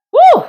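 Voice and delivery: loud, high "woo!"; high whoop. Real, not acted. Exclaims after advice on love.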